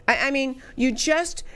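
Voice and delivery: tripping over repeated words in disbelief; stuttering. Real, not acted. Host left speechless by the news, stuttering. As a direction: falter and restart, lost for words. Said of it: "I'm stuttering".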